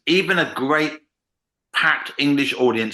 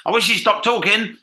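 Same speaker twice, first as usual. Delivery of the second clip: frustrated audience thought; 9 semitones higher, 3 dB louder than usual. Retold or imitated.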